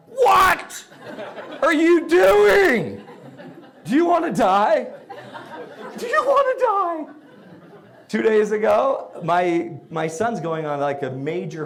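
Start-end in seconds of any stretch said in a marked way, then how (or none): 0.1-7.1 high, loud mock rage at a bad grade; mock furious parent. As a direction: parent yelling at a kid, hammed up with laughter. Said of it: "I'm like".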